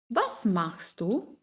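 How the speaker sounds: slow, Was stressed and du rising; question melody. Shown as a demonstration. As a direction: rise on du. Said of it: "Wie zum Beispiel".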